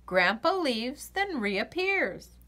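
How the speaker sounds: usual speaking voice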